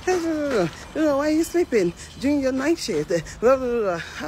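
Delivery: high-pitched mimicked scolding, tumbling into blah-blah; mock angry manager. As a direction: imitate a ranting boss telling someone off. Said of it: "now my manager dey para".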